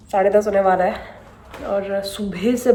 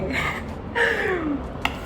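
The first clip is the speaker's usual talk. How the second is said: high giggle; 9 semitones higher, 10 dB quieter than usual. Real, not acted. Laughs at her own language mix-up.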